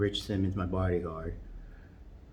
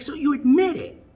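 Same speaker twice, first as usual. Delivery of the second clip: much higher and louder caricature gotcha; high, loud mock Kamala catching him out; triumphant gotcha; 8 dB louder than usual. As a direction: pounce on the admission. Kamala pounces on his answer.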